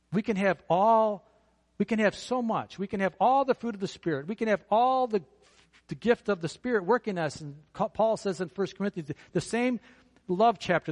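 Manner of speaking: loud, emphatic pulpit preaching; forceful preaching. Acted. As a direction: drive each point home to the congregation. Opening sermon point at full force.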